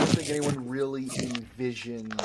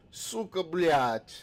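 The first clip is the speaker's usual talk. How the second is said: Russian curse; 6 semitones higher, 6 dB louder, much slower than usual. Retold or imitated. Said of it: "Daniel says in the chat".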